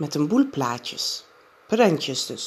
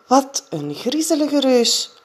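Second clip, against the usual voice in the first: put-on higher, louder girl's voice, spooked; a little girl shivering at a picture; Janneke, spooked; 9 semitones higher, 5 dB louder than usual. A narrator's reading. Janneke reacts to scary giant. (Janneke geschrokken, hoog en luider)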